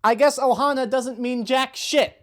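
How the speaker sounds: loud, high, indignant sarcasm; bitterly mad. Real, not acted. Fan betrayed by the remake's ending, genuinely angry. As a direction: spit it out as a bitter jab. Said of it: "I'm actually mad".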